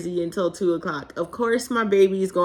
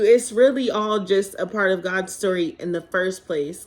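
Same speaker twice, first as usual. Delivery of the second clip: ordinary delivery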